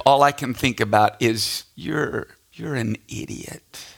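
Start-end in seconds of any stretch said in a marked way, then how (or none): 1.8-3.9 soft inner-voice self-scolding; hushed, deadpan self-rebuke; retold self-reproach. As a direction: drop to a quiet, slow aside to yourself. Retold self-scolding thought during worship.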